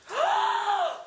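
sudden high gasp of shock; high startled gasp; sharp shocked gasp. Real, not acted. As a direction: suck in air in horror. Discovers more damage.

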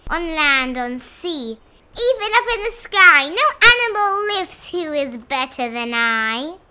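loud rabbit brag, building on each phrase; boastful. A narrator's reading. Rabbit bragging from the hilltop, loud and boastful. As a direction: proclaim it proudly to all. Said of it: "he boasted out loud".

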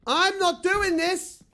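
high, slow terrified yell; panicked shout. Real, not acted. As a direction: refuse in panic, loud and high. Refusing to go on, panicking.

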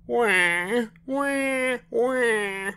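put-on very high-pitched baby "wah"; imitated baby wail. A narrator's reading. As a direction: mimic a baby wailing along to songs. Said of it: "Baby Betsy sang too".

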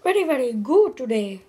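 swooping, hammed-up British accent; jolly British-style accent. Shown as a demonstration. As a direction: greet like a jolly British mate. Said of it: "Okay, next accent".

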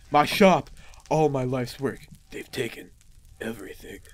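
hero's lament sinking into a hush; acted despair. Reads the bereft hero's dialogue.

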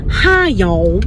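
Southern "Hi, y'all" with the "y'all" drawn through the nose; Paula Deen. Retold or imitated. Trying the impression again, nasal Southern drawl. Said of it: "It's like a nasally y'all".

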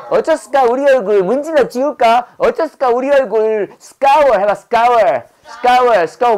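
Jeolla lilt, scour stretched slowly; mock Jeolla pun ending on a slow scour; Jeolla dialect. Teacher links scour to Jeolla dialect pun. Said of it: "전라도 말로 말하는 거예요".

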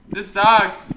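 loud, high burst; frustrated outburst. Real, not acted. Gamer fed up with collecting keys, loud high outburst. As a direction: suddenly yell the complaint.

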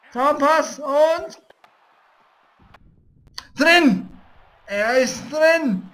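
drawn-out loud goal call; slow triumphant call. Real, not acted. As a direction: stretch it like a commentator. Scoring, commentator-like. (langgezogener Torschrei)

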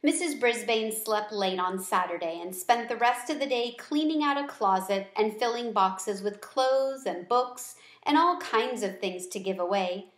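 plain reading voice

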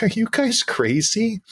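high, slow incredulous question; stunned fan asking old players; disbelieving. Retold or imitated. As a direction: ask it in amused disbelief. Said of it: "I'm like".

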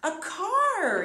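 high, loud, delighted answer; excited reveal. Acted. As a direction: cheer it out like a game show reveal. Teacher reveals picture to children.

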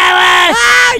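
high, loud yelp of alarm; scream. Pretend. Gunner under fire in the chase, screaming. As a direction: shriek in sudden alarm.

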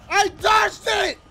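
high, loud, drawn-out yell; outraged shout. Real, not acted. Gamer protesting a dodged attack, outraged shout.

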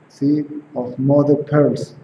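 normal talking voice

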